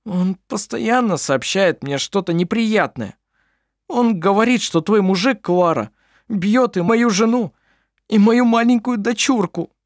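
high, upset man near tears; a distraught brother pouring out woe; tearful simple man. A narrator's reading. Klampin's tearful complaint, voiced high.

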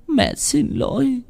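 high, slow wail on xin; the second sorry cracks into crying; mother to child, voice breaking into a sob. Acted. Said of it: "khóc đẫm lệ".

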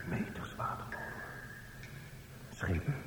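breathless whisper. Acted. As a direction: barely breathe the words. Hushed in the dark.

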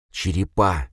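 plain reading voice

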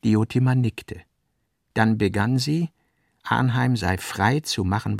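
ordinary talk

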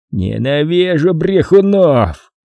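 slow, drawn-out hammed-up roar; big brother bellows at the liar, mock roar. A narrator's reading. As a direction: bellow your contempt. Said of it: "проревел Пит".

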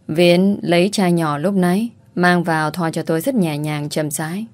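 ordinary delivery

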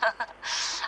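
breathy tipsy giggle. Acted. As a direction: giggle softly, a bit drunk. Angeheitertes Kichern. (beschwipstes Kichern)

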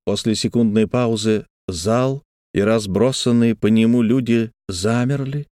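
usual speaking voice

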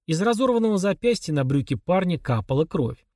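ordinary delivery

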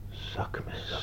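hushed disbelieving whisper; stunned whisper. Acted. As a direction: breathe the word in disbelief. Stunned at the weapon. (verbijsterd gefluister)

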